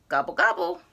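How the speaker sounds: low, loud gobbling; turkey gobble. Retold or imitated. Reviewing the sounds, low turkey gobble. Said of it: "let's see if I got the sounds right".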